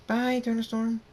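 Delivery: put-on little girls' goodbye. A narrator's reading.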